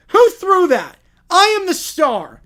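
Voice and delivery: very high, loud furious squeal; outraged diva shriek; angry squeal. Acted. Peppa hit by an egg, squealing angrily. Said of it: "She squealed angrily".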